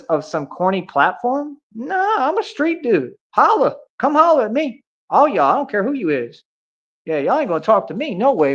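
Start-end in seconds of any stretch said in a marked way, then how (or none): 1.7-6.4 street callout. Real, not acted. Calling out online critics.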